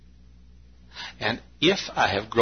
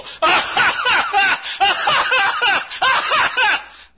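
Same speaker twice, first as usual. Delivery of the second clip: high, loud hearty laugh like a happy churchgoer; imitated joyful laugh; 8 dB louder than usual. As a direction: let out a big joyful belly laugh. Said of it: "he'd go".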